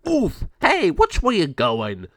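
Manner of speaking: loud, high cartoon grumble after a bump; winded oof, then slow loud complaint; indignant gargoyle. El Jago bumping into another gargoyle, indignant.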